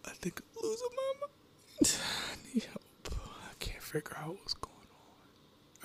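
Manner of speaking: breathy, barely voiced plea; hushed, helpless confession; distraught whisper. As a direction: whisper it as if losing your mind. Distraught, asking for help.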